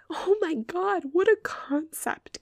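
high, drawn-out mock astonishment; sarcastic amazement. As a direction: act amazed, dripping with sarcasm. Mocks customers who expect no mistakes.

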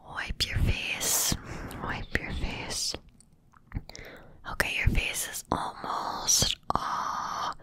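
ordinary talk, nothing marked

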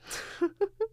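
short high chuckle; amused laugh. Real, not acted. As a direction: laugh at your own punchline. Laughs at her own punchline.

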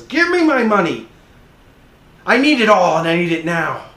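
loud, high shouted demand; high-pitched yell, pitch falling as it goes; angry demand. Acted. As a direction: shout it like a creditor out of patience. Acted creditor demanding money violently.

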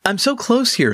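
high, quick tinkerer gnome; a chirpy little gnome realizing his mistake; excited gnome voice. Acted. Gnome Sprocket's voice.

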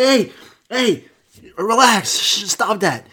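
high-pitched dog telling someone to back off; protesting dog. Acted. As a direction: play a rattled dog calming someone down. Voicing the dog pushing back.

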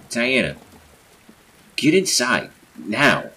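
loud, high, urgent command; shouted order. A narrator's reading. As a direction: call them in, forcefully. Acted order called to Diana outside.

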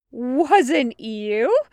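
loud, disbelieving echo; incredulous captain. A narrator's reading. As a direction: throw his words back in disbelief. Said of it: "Aveline said incredulously".

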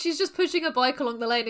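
normal talking voice

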